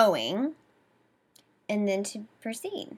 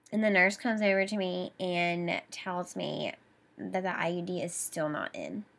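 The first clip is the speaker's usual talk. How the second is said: normal talking voice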